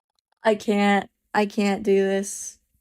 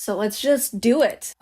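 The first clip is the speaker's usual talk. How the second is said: usual speaking voice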